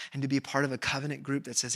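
usual speaking voice

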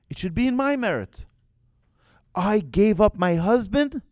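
high, loud, emphatic protest; impassioned claim. Retold or imitated. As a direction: stake your claim with full force. Rachel claiming her merit before God.

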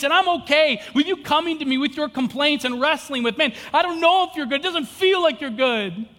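high, loud impassioned sermon; full-force preaching. Acted.

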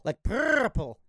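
'purple' with a long rolled R; purring purple. Shown as a demonstration. Host showing the purr, trilled 'purple'. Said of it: "It's a little purr in there".

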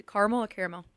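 "caramel" as CAR-mel, then as CARE-mel; caramel two ways. Shown as a demonstration. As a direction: contrast the first vowel of caramel, car versus care. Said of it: "And how do you".

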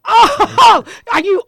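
high, loud, fast self-celebration; excited. Real, not acted. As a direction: crow about your own success. Celebrating nailing a hard word.

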